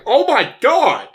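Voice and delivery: high, slow, drawn-out gasp of disbelief; mock shock. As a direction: stretch it out in hammed-up astonishment. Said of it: "I'm like".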